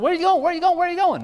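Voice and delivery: high, loud repeated pleading; anxious disciples. Acted. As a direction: pester like worried children. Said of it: "And they're saying".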